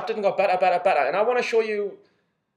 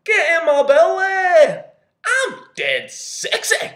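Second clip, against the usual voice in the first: loud, slow, high put-on Scottish accent with a Fat Bastard growl; 13 semitones higher, 10 dB louder, much slower than usual. Showing off his Scottish accent to a girl in a bar, loud hammed-up Scottish. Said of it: "try me Scottish accent".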